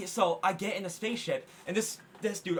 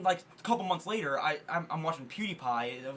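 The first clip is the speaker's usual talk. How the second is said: ordinary talk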